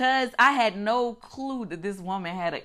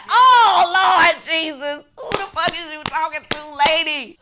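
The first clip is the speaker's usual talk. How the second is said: indignant outburst; 7 semitones higher, 8 dB louder than usual. Real, not acted. Recalling "calm the fuck down.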